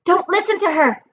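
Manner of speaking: high-pitched urgent call; shouted warning. A narrator's reading. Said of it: "Annabeth's voice shouted".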